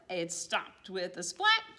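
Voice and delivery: ordinary delivery, nothing marked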